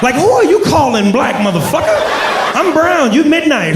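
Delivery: squeaky outraged comeback; indignant. Acted. Comic indignant rant at insult.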